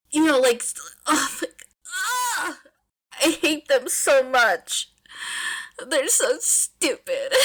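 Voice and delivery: high, drawn-out fond groan; exasperated whine. Real, not acted. Fan reader groaning at the oblivious couple, whiny. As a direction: wail it like they're torturing you.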